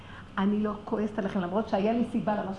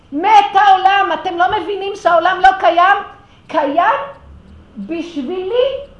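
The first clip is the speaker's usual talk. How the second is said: passionate outcry; 8 semitones higher, 15 dB louder than usual. Retold or imitated. Impassioned retold speech to them.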